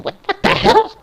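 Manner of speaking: high-pitched, loud startled cry; shocked outburst. Acted. Commentator spotting intruders in the office, shocked. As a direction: blurt it out in stunned disbelief.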